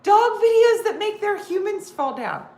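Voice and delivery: high, loud squealing laughter through the words; telling her husband about the videos, high squealing laugh; giggly answer. Retold or imitated. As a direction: squeal it out, barely holding it together. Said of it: "I'm like".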